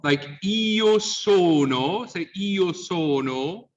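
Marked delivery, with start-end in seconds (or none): 0.4-2.1 slow, high "io sono" with closed Os. Shown as a demonstration. Teacher giving an example, closed O in "io sono". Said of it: "Or closed O". 2.4-3.7 slow "io sono" with closed Os. Shown as a demonstration. Teacher asking the class to repeat, closed O in "io sono". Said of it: "Or closed O".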